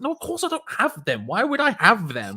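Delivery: high-pitched exasperated denial, retold; defensive, incredulous pleading; indignant protest. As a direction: act out protesting innocence to mum. Protesting innocence to his mum.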